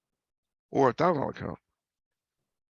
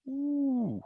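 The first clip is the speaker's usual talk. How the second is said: a high, sudden ooh of surprise; impressed high-pitched ooh; surprised ooh; 13 semitones higher, 5 dB quieter than usual. Real, not acted. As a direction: gasp it out high, amazed at the chart. Reacting to big chart move.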